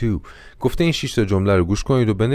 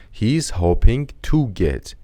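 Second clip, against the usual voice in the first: slow model phrase; slower than usual. Shown as a demonstration. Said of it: "to get یا getting".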